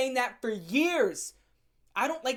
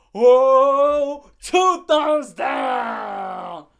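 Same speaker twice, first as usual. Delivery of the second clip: long drawn-out loud wail, much louder; hammed-up shout; 5 semitones higher, 14 dB louder, much slower than usual. Real, not acted. Vlogger dramatically rating the loser, drawn-out loud wail. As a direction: overdo the verdict like a drawn-out cry.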